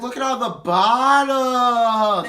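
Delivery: high, loud drawn-out whine; drunk whine. Retold or imitated. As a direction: moan about the mess. Imitating drunk Donette.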